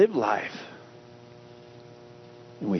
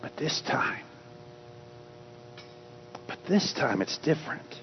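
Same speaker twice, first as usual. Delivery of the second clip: hushed; 9 dB quieter than usual. Acted. Quiet build-up before preaching.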